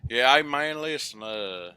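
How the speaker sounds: loud folksy hillbilly talk; hillbilly accent. Retold or imitated. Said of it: "I sound like a hillbilly".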